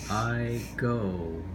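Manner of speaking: normal talking voice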